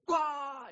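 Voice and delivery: sudden high yelp to startle the puppy; startle shout. Retold or imitated. Pastor jumping out at the puppy, loud high yelp.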